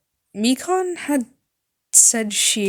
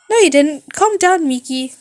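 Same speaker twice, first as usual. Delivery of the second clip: put-on high, loud bubbly girl voice; high loud cheerful thanks; chipper rock girl; 6 semitones higher, 5 dB louder than usual. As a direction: toss it off brightly like a rock star. Ibuki calming nurse.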